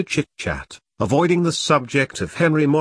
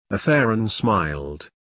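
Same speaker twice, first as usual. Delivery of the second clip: ordinary delivery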